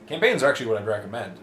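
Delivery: ordinary talk, nothing marked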